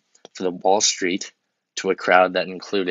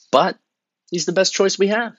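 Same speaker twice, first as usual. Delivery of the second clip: much higher insistent plea; pleading; 11 semitones higher, 4 dB louder than usual. Acted. Urging voters toward Biden, a high plea. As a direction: push it up, almost begging.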